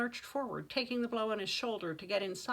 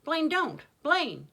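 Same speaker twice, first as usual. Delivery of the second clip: high, loud plea to stop; urgent call; 7 semitones higher, 9 dB louder, slower than usual. A narrator's reading. Judith calling after Blaine as he runs off, urgent shout. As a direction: cry out his name, high and loud.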